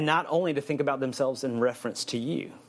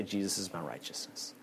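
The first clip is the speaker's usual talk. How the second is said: softer, murmured declaration; quiet affirmation; 6 semitones lower, 11 dB quieter than usual. Shown as a demonstration. Preacher modelling self-talk to listeners, soft murmur. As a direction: say it softly to yourself. Modelling what to tell yourself.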